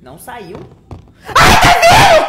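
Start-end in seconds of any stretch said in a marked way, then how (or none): 1.4-2.3 high scared shout with a laugh; panicked laughing shriek. Real, not acted. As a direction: shriek as the monster comes. Monstro se aproximando. (grito nervoso rindo)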